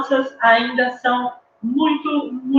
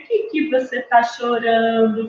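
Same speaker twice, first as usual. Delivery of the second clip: put-on talking to a baby girl. Adult to a crying baby in pink, gentle cooing. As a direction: ask a baby girl tenderly, without pushing. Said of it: "vai alguém e fala assim".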